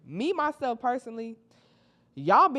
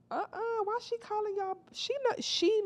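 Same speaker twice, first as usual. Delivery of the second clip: much higher, baffled question; incredulous. Real, not acted. Questioning the new girl's greeting in disbelief. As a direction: ask it in disbelief.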